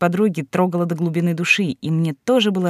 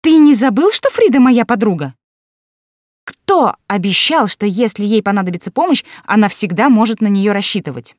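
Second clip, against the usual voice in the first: high, loud, pointed mock threat; 4 semitones higher, 6 dB louder than usual. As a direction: lean on him with theatrical menace. Friend pressing boyfriend with threat.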